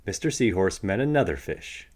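usual speaking voice

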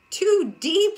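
high, loud cry; cried out. Acted. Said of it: "she cried".

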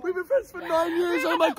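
mimicking a sentimental drunk mate; drunk friend impression. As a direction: put on a weepy, clingy drunk. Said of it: "This is my impression of, uh, Summer whilst drunk".

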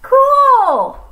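very high loud cheer; excited cheer. Acted. Snake thrilled to try catch, cheering loudly. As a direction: burst out with delight.

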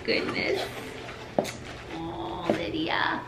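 breathless whisper of awe; awed whisper. Real, not acted. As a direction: whisper her name, overwhelmed. Opening gift, whispered awe.

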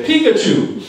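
PIKACHU with a high, punched first syllable; American pronunciation. Shown as a demonstration. Evaluator teaching the room American English pronunciation, first-syllable stress. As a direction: say Pikachu as "PEE-kuh-choo". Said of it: "in American English, we say".

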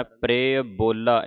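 ਪ੍ਰਿਯ ਬੋਲਾ said slowly with the r joined; correct pronunciation. Shown as a demonstration. Teacher modelling the right reading for the class, slow. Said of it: "ਇਸ ਤਰ੍ਹਾਂ ਉਚਾਰਨ ਕਰਨਾ ਹੈ".

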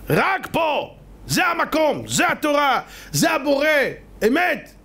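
high-pitched, punched short declarations; fervent proclamation. Real, not acted. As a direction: proclaim each phrase as absolute truth. Emphatic climax of the sermon. (הכרזה נלהבת בקול גבוה)

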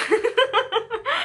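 high-pitched giggle. Real, not acted. Laughs after not me.

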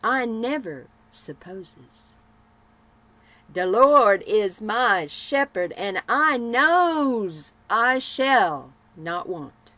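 slow, loud and firm Nancy; joyous Nancy. Acted. Poor joyous Nancy answering the gloomy lady, slow loud faith. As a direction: declare it slowly with full faith. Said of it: "cried Nancy".

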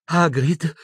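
high-pitched frightened plea; terrified Hermione. A narrator's reading. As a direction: call his name in alarm, high. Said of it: "intervenne Hermione atterrita". (atterrita, voce acuta)

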